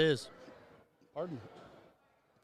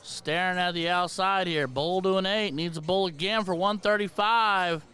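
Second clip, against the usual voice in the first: play-by-play; 3 semitones higher than usual. Acted. Darts play-by-play commentary.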